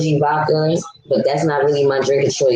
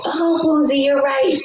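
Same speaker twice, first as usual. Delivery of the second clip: higher mocking voice of eager fans; 9 semitones higher than usual. Commenters cheering Boozy on, in a higher mocking voice. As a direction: ham up the gushing commenters. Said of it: "and they".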